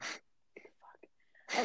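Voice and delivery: whispered curse to oneself; muttered aside. Retold or imitated. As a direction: whisper it privately, barely any voice. Swearing privately while scanning.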